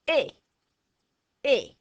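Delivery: "Eh" said with a bright emphatic push; emphatic French é, "Eh"; Italian-style é sound. Shown as a demonstration. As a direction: say "Eh, eh" like an Italian making a point. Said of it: "they emphasize something and go".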